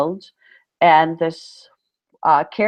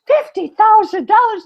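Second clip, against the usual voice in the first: much higher, louder, astonished; amazed; 5 dB louder than usual. Retold or imitated. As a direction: gasp out the sum in disbelief. Mishearing the grant amount.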